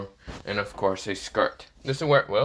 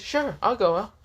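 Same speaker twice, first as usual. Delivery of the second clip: cheerful tone. Acted. Issei fakes delight at the date offer, high and cheerful. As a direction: gush like a happy dupe.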